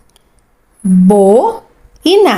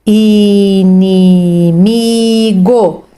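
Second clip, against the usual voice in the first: "inimigo" stretched out very slowly; 2 semitones lower, much slower than usual. Shown as a demonstration. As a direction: read "inimigo" stretched for a beginner. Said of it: "sílabas". (bem lento e esticado)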